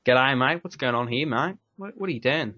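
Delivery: put-on Australian accent. Shown as a demonstration. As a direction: show off a casual Australian accent. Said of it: "I can do a reasonably good, uh, Australian accent".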